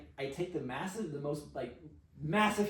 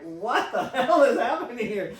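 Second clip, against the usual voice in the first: high, very loud, laughing question; astonished outburst; 8 semitones higher, 13 dB louder than usual. Retold or imitated. Storyteller reacting to the women's confessions, comic astonished outburst. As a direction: blurt it out, cracking up in disbelief.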